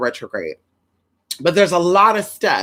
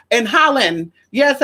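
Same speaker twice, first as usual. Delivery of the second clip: ordinary talk, nothing marked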